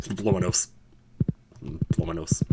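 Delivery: "nose" ending in a crisp S; quick "blow my nose" with "nose" as "nohss"; unvoiced S closing "nose". Shown as a demonstration. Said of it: "It just sounds so weird".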